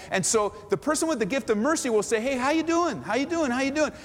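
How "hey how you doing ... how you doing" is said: bright high greetings, tossed quickly round the room; upbeat, much higher greeter voice; cheery greeting. Retold or imitated. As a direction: call out hellos to everyone as you pass. Mercy person greeting round room.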